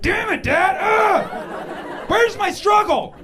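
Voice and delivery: high, loud mock tantrum; mock outrage. Son fake-yelling at his dad for supporting him, high mock tantrum. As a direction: shout at the sky in fake despair.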